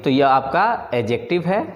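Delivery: usual speaking voice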